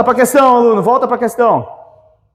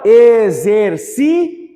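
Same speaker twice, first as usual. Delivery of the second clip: slow syllable split; much slower than usual. Shown as a demonstration. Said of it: "teacher separates syllables".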